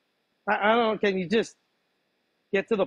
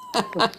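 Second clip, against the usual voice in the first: burst of laughter. Real, not acted. Caught off guard, laughs.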